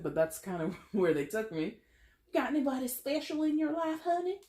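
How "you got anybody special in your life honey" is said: put-on high, broad southern accent. Religious family prying into your love life, thick southern accent. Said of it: "I put on this southern accent".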